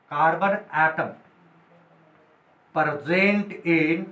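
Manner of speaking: word by word, very slow, pausing for notes; slow dictation. Shown as a demonstration. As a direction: dictate so students can write it down. Teacher dictating notes slowly. (धीमे-धीमे लिखवाते हुए)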